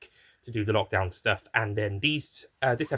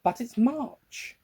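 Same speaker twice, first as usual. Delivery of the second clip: surprised; 12 semitones higher, much slower than usual. Acted. Character reacts in the sketch.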